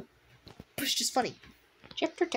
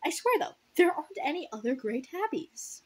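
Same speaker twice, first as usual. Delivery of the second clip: usual speaking voice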